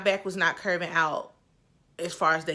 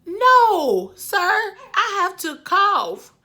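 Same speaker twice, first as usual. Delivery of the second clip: pleading, insistent; 11 semitones higher, 7 dB louder, much slower than usual. Retold or imitated. Retold pleading with the doctor.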